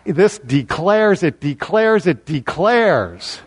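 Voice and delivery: repeated stress on "declares", the final one stretched; building emphasis on declares. Shown as a demonstration. Preacher showing the Greek's special emphasis, insistent stress on "declares". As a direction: hammer each "declares", drawing the last one out long and falling. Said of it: "he's putting special emphasis on it".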